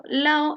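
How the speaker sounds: "lao" said with no D between the vowels; "lao" for "lado", D swallowed; "lao", two vowels run together; dropped D. Shown as a demonstration. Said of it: "eliminación frecuente de la D intervocálica".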